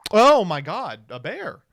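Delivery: imitating the clip. Streamer mimicking the bear clip they just played, loud imitation. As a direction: mimic the clip's big startled exclamation. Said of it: "I love the lip smack".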